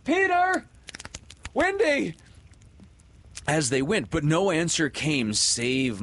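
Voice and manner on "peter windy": high, loud calls across the water; shouting names. A narrator's reading. Boys rowing home shouting for Peter and Windy, loud calls. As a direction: call out for them at full voice.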